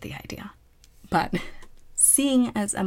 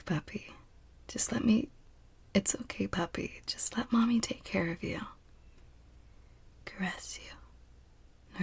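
gentle soothing whisper; 5 semitones higher, 6 dB quieter than usual. Acted. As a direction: lull them softly in a whisper.

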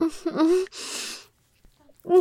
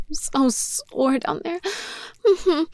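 ordinary delivery

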